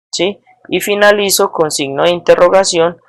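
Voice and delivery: ordinary talk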